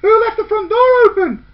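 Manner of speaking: loud, high call across the house; shouted question. A narrator's reading. Girl home to an open front door, shouting. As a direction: shout it out, alarmed. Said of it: "said one of them".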